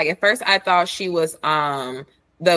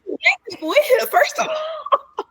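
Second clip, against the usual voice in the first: exasperated. Retold or imitated. Exasperated scolding peak.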